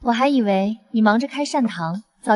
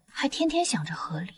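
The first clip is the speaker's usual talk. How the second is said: whispering; 3 semitones lower, 9 dB quieter than usual. Acted. Muttering to herself.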